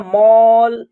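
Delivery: "मॉल" with a long 'aw' vowel; teacher's stretched model "मॉल", A as 'aw'; rounded 'aw' sound. Shown as a demonstration. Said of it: "ए का उच्चारण अ के रूप में".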